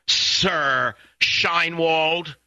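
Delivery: slow, drawn-out sneer at a posh English title; mock posh English. Talk host mocking the British ambassador's title in slow mock posh English. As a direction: stretch it out like a snooty English lord. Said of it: "what kind of snotty accent you put on".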